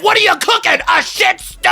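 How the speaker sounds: incredulous shout. Real, not acted. Mocking the cooking video.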